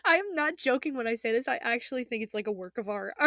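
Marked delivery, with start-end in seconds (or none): none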